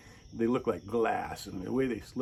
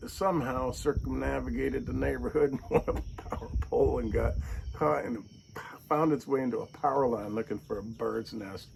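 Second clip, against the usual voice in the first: ordinary delivery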